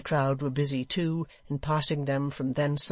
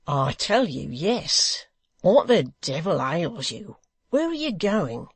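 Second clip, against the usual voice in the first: louder, exasperated; irritated Hugh; 3 semitones higher, 4 dB louder than usual. Acted. Hugh snapping at the departing hangman, exasperated. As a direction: lose patience.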